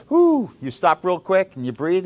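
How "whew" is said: winded whew. Retold or imitated. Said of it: "and you're like".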